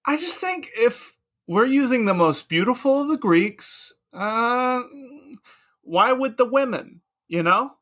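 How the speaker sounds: loud, leering philosopher; eager Socrates. Acted. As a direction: sell it with hungry enthusiasm. Socrates pushing male-only games.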